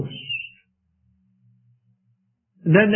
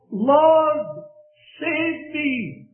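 desperate cry; 9 semitones higher, much slower than usual. Acted. Peter sinking cries to the Lord, high desperate call. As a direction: cry out to be rescued. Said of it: "he cries out".

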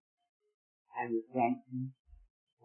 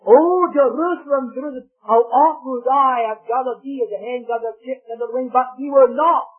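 high, loud preacher's lament; declaimed anguish, pitched high and loud; crying out in lament; 9 semitones higher, 8 dB louder than usual. Acted. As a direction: cry it out over the city, acted. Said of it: "he cried and he wept".

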